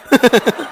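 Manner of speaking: short burst of laughter; amused laugh. Real, not acted. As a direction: laugh at your own story. हँसी, अपने ही किस्से पर. (अपनी बात पर हँसी)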